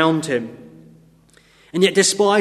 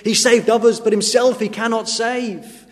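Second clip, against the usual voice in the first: mocking jibe; 6 semitones higher than usual. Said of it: "the mocking and the jibes that people gave".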